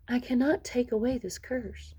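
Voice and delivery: soft, gentle fairy voice; a kind fairy speaking softly to comfort the court. Acted. Kind fairy softens the curse.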